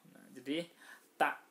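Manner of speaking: ordinary talk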